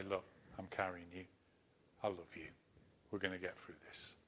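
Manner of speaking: soft, low, gentle reassurance; tender comfort. Pretend. God comforting a frightened listener, soft and tender. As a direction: hold them close with the voice. God's comforting words again.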